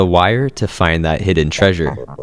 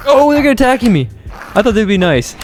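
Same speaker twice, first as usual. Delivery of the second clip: alarmed; 12 semitones higher than usual. Real, not acted. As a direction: yelp as the crabs attack.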